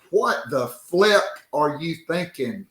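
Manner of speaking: usual speaking voice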